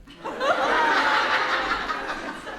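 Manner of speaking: high breathy burst of laughter; laughing hard at the joke; big laugh. Real, not acted. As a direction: crack up at the punchline. Comedian laughs at own punchline.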